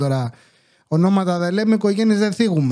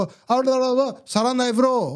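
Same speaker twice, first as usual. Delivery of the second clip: high-pitched imitation of broken-Greek chatter; mock haggling neighbour; 5 semitones higher than usual. Neighbour talking deals and euros, high mock jabber. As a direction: imitate the neighbours going on about money. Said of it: "Μιλάνε, οκ, σπαστά ελληνικά".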